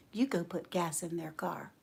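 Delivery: low, very soft command; near-whisper, calm and sure; hushed instruction. Retold or imitated. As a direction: voice the Lord quietly telling her. Voices the Lord's quiet instruction.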